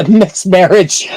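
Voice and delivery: loud, high, drawn-out laughing words; slow and loud through laughter. Real, not acted. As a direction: crack up while saying it. Host cracks up at joke.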